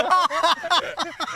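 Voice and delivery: squealing laugh; high laugh. Real, not acted. Salesman laughs at his coworker's lines. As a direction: crack up high.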